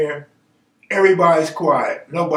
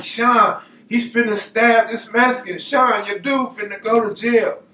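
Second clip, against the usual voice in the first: higher, urgent mock alarm; alarmed warning; 6 semitones higher than usual. Warning Sean that Bobby may stab someone, mock alarm. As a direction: warn a friend, voice pitched up.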